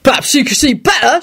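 understated indignant shout; shouting constable. A narrator's reading. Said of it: "shouted the constable".

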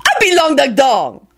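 put-on accented old man. Old man proudly names himself.

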